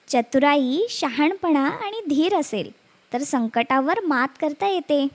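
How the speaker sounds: usual speaking voice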